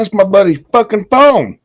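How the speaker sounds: loud, high angry retort, retold; instantly shitty. Calling out whoever took buddy's phone, instantly shitty. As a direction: snap it high and loud, acting out the anger. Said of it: "I was instantly shitty".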